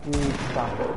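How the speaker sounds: loud frustrated plea; annoyed shout. Real, not acted. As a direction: yell it out, fed up. Pleading as enemy chases him.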